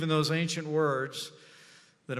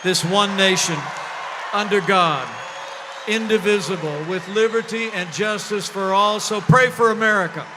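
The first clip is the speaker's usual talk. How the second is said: rising, louder preacher call; preaching; 4 semitones higher, 8 dB louder than usual. Real, not acted. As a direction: build it like a sermon close. Closing prayer call, building loud.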